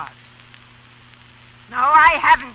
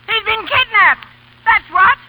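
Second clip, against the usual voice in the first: alarmed outburst; 9 semitones higher, 7 dB louder, slower than usual. Acted. Grandmother decides grandson kidnapped.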